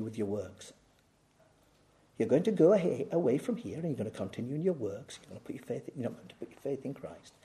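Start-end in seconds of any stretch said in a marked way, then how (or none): 2.2-7.3 hushed warning. Acted. Sober quiet warning to congregation.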